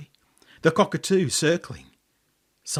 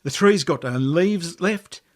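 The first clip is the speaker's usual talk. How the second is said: ordinary delivery, nothing marked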